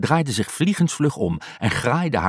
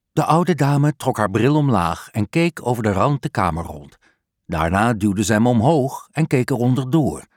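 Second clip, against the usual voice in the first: ordinary delivery, nothing marked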